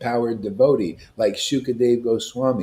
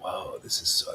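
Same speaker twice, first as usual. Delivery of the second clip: breathless whisper of awe; 9 dB quieter than usual. Retold or imitated. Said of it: "it's like".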